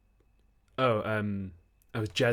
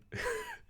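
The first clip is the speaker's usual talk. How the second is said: high squeak of laughter; 9 dB quieter than usual. Real, not acted. Laughs listing nicknames.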